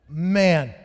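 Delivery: ordinary talk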